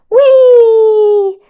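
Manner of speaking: high, loud thrilled squeal; excited whee. Acted. Mohammed zooming on the roller coaster, squealing with glee. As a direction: squeal like you're flying down the coaster.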